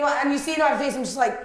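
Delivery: ordinary talk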